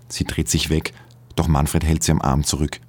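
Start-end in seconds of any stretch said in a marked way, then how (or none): none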